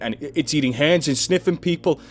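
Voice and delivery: high, loud, rushed pleading; panicked shouting. A narrator's reading. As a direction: babble it out in terror. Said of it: "I shouted at him".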